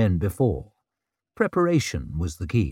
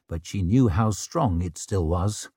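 ordinary delivery, nothing marked

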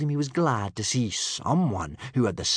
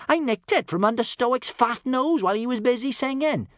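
high, loud, breezy boast; 7 dB louder than usual. A narrator's reading. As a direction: toss off your theft gaily. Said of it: "said Kamikaze breezily".

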